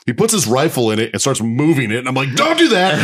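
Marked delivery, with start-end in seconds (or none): 2.3-2.9 much higher and louder, sudden alarmed cry; high startled shout of don't do that; panicked yelp. Retold or imitated. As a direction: yelp it out as the rifle stabs the wire. Soldier jabs rifle into wire.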